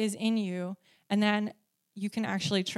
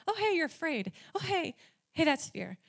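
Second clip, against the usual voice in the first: a lightly voiced God, higher and playful; God pointing out her fear in a higher, lighter voice; gentle nudging voice; 6 semitones higher, 3 dB quieter than usual. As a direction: tap her on the shoulder, high and light. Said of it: "God's been like".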